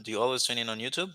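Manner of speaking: ordinary talk, nothing marked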